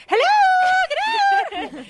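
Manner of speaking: high, loud, sing-song wake-up call; bright shout to wake everyone; chipper shout. Pretend. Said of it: "You just woke up everybody with that".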